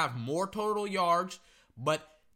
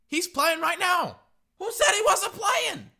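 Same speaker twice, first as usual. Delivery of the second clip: loud, high, outraged; indignant shout; 12 semitones higher, 6 dB louder than usual. Real, not acted. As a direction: shout it out in disbelief. Indignant rant at doubters.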